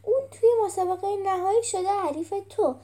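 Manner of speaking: high, loud, emphatic telling-off; scolding character. A narrator's reading. Character scolding someone in story.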